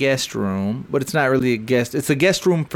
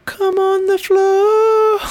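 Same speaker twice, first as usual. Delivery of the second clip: much higher, very slow crooning; drunk sing-song; 4 dB louder, slower than usual. Retold or imitated. Drunk Corey crooning to his wife, high slow mock croon. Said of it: "he goes".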